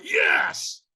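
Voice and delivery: high, straining shouted yes; stronger yes. Retold or imitated.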